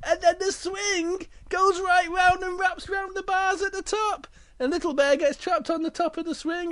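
very high, breakneck-climax storytelling; excited narration. A narrator's reading. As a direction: narrate the mishap at a high pitch.

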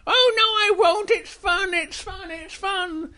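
very high, gleeful squeak; giddy little bear. Acted. Little Bear refuses Big Bear's warning, giddy squeak. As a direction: chant back in a tiny high voice.

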